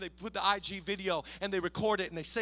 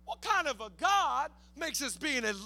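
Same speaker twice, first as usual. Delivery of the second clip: raised, forceful sermon cry; emphatic preaching; 5 semitones higher, 4 dB louder than usual. Real, not acted. Preacher telling congregation who put Daniel in the den, emphatic preaching. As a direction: drive each point home, swelling louder.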